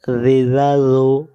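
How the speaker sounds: রি জা জু said with soft tongue-tip জ; জ in জা জু made soft from the tongue tip; soft zha. Shown as a demonstration. Teacher demonstrating soft zal letter sound. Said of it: "নরম করে।".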